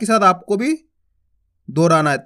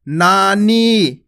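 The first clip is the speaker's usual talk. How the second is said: नानी held long on each vowel; drawn out; much slower than usual. Shown as a demonstration. Teacher drawing the word out for children. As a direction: stretch नानी very slowly. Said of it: "खींच खींच के उच्चारण करना है".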